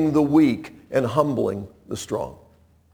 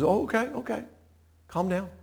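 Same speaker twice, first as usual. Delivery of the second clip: soft, high hushing voice; soothing; 7 semitones higher, 10 dB quieter, faster than usual. Acted. Jael hushing the frightened man, soothing. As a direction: gently settle a panicked guest.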